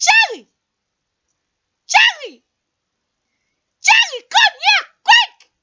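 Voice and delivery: high call across the house. Acted. Caller calling out for sister Cherry. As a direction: call the sister over, rising to quick.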